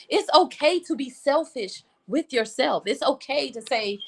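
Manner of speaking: ordinary talk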